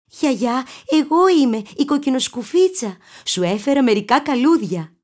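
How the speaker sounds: put-on girl voice. A narrator's reading.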